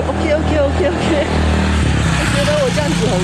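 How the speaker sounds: high, breezy repeated okays tossed off in the friend's voice; mimicking her friend. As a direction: play the friend brushing her off with quick okays. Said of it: "他就跟我说".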